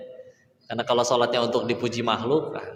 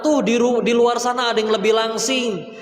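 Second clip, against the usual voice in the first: higher, teasing whisperer of desire with laughter; the lustful inner voice luring a husband, higher and laughing; voice of temptation; 8 semitones higher than usual. Acted. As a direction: tempt him like a giggling inner devil. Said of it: "hawa nafsuku berkata".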